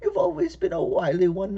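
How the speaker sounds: put-on high, creaky old woman voice; little old lady voice. Old lady teasing Lyle.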